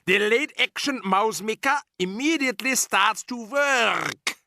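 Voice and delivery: high, slow witch voice; a sinister foreign witch boss; Grand High Witch. Acted.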